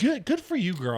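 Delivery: high, loud mocking praise; sarcastic praise. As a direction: cheer her on with fake enthusiasm. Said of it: "I'm like".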